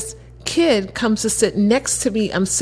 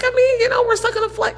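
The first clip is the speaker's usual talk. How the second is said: much higher, wheedling; pleading young guy. Retold or imitated. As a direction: talk her into chatting, pitched up. Josh bargaining.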